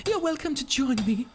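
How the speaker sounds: high, hammed-up British accent; British character voice. Miner character calling to the player, high British accent. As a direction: voice the cave dweller in a lilting high British accent. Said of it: "made a British voice for all of the characters".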